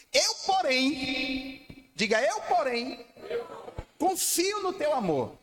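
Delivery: high, slow, lifted voice of trust; the changed man's soaring confidence; a different man. Acted. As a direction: rise up as the psalmist who still trusts. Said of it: "Ah, que homem diferente".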